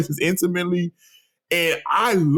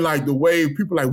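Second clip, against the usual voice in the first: usual speaking voice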